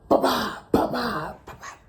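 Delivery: vocal rifle fire, trailing away; imitated gunfire. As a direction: mouth quick gunshot bursts, fading out. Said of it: "I'm at the range shooting".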